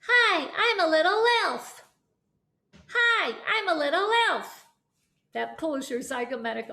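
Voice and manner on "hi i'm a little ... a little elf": high, forward, squeaky elf; little elf voice. Shown as a demonstration. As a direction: sound like a tiny elf. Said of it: "pull their ears".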